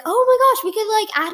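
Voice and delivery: high-pitched gush of a new idea; retold excitement. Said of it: "when I was like".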